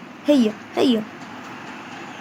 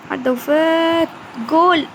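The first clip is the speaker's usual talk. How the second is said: excited shout; 5 semitones higher, slower than usual. Real, not acted. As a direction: burst out with joy. Near goal excitement.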